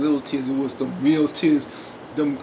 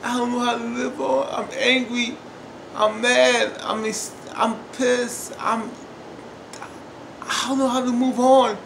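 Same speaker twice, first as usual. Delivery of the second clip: high-pitched, halting distress; imitating a grieving woman; acted raw grief; 6 semitones higher than usual. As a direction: plead it high, breaking off between phrases. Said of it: "the real emotions".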